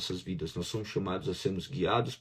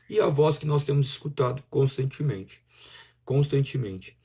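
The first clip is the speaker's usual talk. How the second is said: ordinary talk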